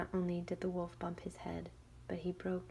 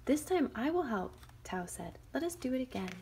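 higher, brighter promising call; eager girl; 6 semitones higher, 4 dB louder than usual. Acted. Tao calling to the wolf. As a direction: offer help eagerly.

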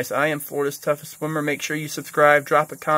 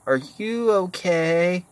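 mocking concern; 6 semitones higher, much slower than usual. Mocking the paddleboarders' question.

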